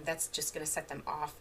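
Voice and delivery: normal talking voice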